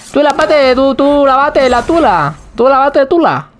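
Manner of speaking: high cartoonish taunt; mocking high voice. Teasing wordplay in squeaky voice.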